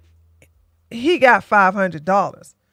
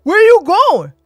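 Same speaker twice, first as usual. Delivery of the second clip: louder, higher demanding question; mad; 9 semitones higher, 5 dB louder than usual. Retold or imitated. College guy mad she's leaving, louder demand. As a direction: demand to know where she's going. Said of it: "And he was mad".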